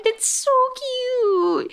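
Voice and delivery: very high, drawn-out gush; cutesy squeal. Real, not acted. Podcaster gushing over cat on Spike's head, slow high squeal. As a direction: squeal it slowly over something adorable.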